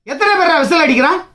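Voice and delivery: ordinary delivery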